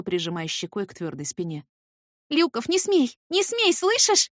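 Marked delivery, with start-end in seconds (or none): 2.3-4.3 high loud pleading, slowing at the end; desperate plea. Acted. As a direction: beg him not to. Pleading to stop the fight.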